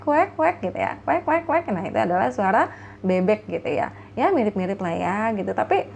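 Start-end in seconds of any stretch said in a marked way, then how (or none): 0.1-1.7 high, playful quacks; duck quack imitation. Shown as a demonstration. Teacher imitates an Indonesian duck for the class, high quacks. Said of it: "kita akan bilangnya wek".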